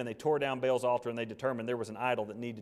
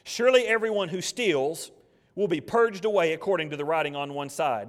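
preaching scripture; 2 semitones higher, 7 dB louder than usual. Acted. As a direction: proclaim the verse to the whole church. Reads scripture at full force.

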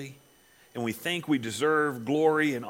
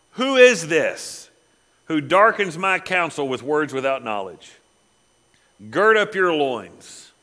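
loud, slow, booming higher voice from the storm; voice of God; 4 semitones higher, 8 dB louder, slower than usual. Acted. God answering Job from the tornado, slow and booming. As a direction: thunder it down from the whirlwind.

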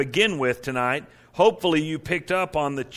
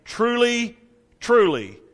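"Truly" held high, then "truly" falling back; emphatic opening; 8 semitones higher, 3 dB louder, much slower than usual. Acted. Jesus opening what he wants heard, emphatic "Truly, truly". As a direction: ring out the first "Truly", let the second "truly" drop. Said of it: "really wants you to hear".

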